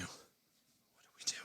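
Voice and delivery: the same question again, slow and breathless; frightened whisper. Acted. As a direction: repeat it in a shocked, hushed whisper. Panicked soldier Hughes repeating.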